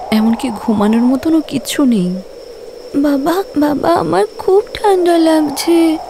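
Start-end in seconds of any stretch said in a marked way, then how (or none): 3.0-6.0 put-on high child's voice calling out to her father; a shivering five-year-old pleading; cold little girl. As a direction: daughter tells father she's freezing, high and childlike.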